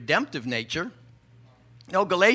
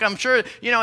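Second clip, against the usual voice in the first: ordinary talk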